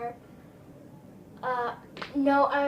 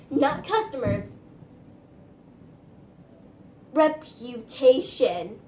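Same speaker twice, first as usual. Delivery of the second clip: Reputation louder and drawn out slowly; stress on Reputation; 7 dB louder, slower than usual. Real, not acted. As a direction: punch Reputation hard and slow. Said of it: "own emphatic correction".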